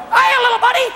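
high, loud cartoon squeak; piercing falsetto greeting; Mickey Mouse. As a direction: chirp a hello like a giant cartoon mascot. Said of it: "Mickey Mouse walks up".

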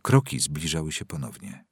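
usual speaking voice

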